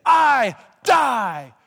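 put-on loud, high British-accented chant of I die, he lives; booming British-accent preacher cry; shouted British-accented imitation. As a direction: belt it like an old British preacher. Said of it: "With a British accent, he would say".

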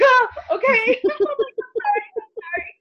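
meek and rattled, apologizing fast; startled apology. Retold or imitated. Scolded, apologizing to booming voice.

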